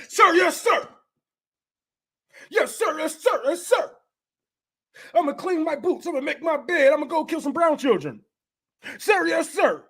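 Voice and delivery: lightly voiced drill recruit, high and barked; mock soldier. Comic mimicking a soldier answering his sergeant, mock bark. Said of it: "mocking a soldier reciting".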